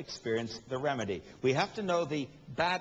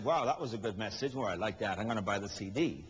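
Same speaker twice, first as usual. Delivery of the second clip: mock satisfied churchgoer; 6 semitones lower than usual. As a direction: lightly imitate a pleased listener. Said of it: "Do you think they said".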